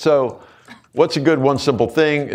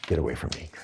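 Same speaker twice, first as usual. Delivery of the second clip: muttered; 9 semitones lower, 12 dB quieter, much faster than usual. Retold or imitated. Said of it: "that's code for".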